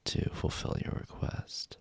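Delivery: ordinary talk, nothing marked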